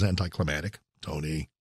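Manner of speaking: a light jab at a sportscaster's "anticlimactic"; Tony Romo style. Retold or imitated. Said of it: "as Tony Romo says".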